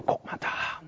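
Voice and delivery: a whispered tip-off between pews; whispered gossip. Retold or imitated. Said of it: "da ging dann so das Getuschel los". (getuscheltes Flüstern)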